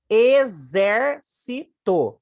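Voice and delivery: "Exército" slow and loud, syllable by syllable, strong ZÉR; stress on antepenultimate syllable. Shown as a demonstration. Teacher showing students the strong syllable, slow syllabified stress. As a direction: break up "Exército", punching ZÉR. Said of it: "antepenúltima mais forte".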